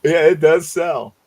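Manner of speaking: ordinary delivery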